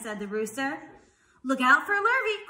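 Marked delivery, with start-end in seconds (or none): 1.5-2.5 high, loud warning; calling out. A narrator's reading. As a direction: call a warning across the farm. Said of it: "called the cows".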